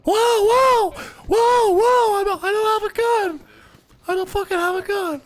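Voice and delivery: very high, loud falsetto shriek; shrieking fake lady voice. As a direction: panic squeal in a put-on woman's voice. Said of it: "Disguise your voice like a lady?".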